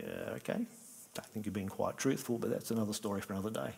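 skeptical aside. Acted.

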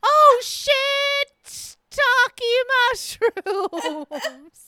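high, loud startled shout; retold panic yell on a golf cart; panicked yell. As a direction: yelp it as the cart slides sideways. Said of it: "and I was like".

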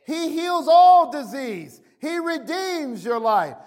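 high-pitched, driving sermon delivery; impassioned preaching. Real, not acted. Preacher declaring redemption from the curse to his congregation, impassioned preaching. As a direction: preach it with rising fervor.

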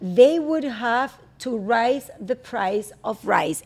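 very slow, careful repeat of the tongue twister; slow repetition. Shown as a demonstration. Host repeats the tongue twister after the guest, slowly. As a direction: copy it word by word, slowly. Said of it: "la importancia de la pronunciación".

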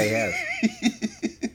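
laughing. Real, not acted. Teasing over scores.

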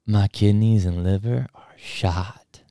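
slow, hazy stoner voice; stoned drawl. Retold or imitated. Stranger asking school worker for organs, slow hazy drawl. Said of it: "And he goes".

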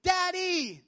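put-on high, slow, loud child voice; a small girl announcing she is happy; little daughter. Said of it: "has run up to me and said".

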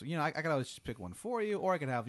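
quick, breezy host's offer, lightly played; clown dream host.

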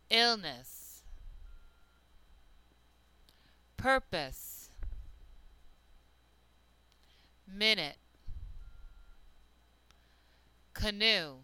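slow, clear list reading; careful teacher reading words one by one. Shown as a demonstration. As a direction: read each word aloud as a model. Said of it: "Try to listen for the schwa sound".

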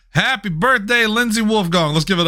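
high, bright birthday call-out; excited announcement. Acted. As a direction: hype up the birthday girl. Birthday shout-out to audience.

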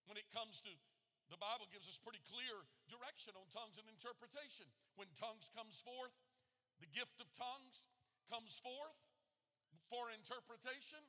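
ordinary delivery

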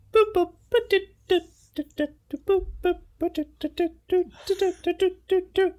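high beeping dial tones; space phone beeps. Acted. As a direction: beep out the alien phone number. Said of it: "it's a space phone".

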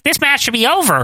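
high, mock-concerned announcer voice; concerned Kevin Kelly. Co-host imitating the announcer's worry about the match, high mock concern. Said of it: "sort of concerned".

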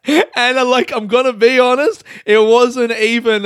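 high, loud amused self-defence; embarrassed, laughing justification; amused protest. Real, not acted. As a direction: protest through real laughter. Laughing defence of watching the video.

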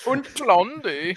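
slow, high hammed-up German accent on "Und Blondie"; mock German. Riffing on Hitler's dog, mock German accent.

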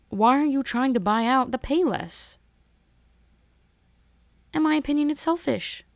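usual speaking voice

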